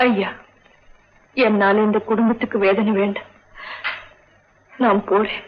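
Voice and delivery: low-pitched, subdued farewell; quietly resigned, pitch sunk low; sad resignation. Acted. Nurse offers to leave the troubled family, sad resignation.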